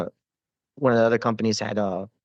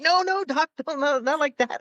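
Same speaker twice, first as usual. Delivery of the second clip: much higher panicked voice of the captain; alarmed officer. Retold or imitated. Captain stopping the medic drawing his knife, high alarmed protest. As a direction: stop him in a high, hurried alarm.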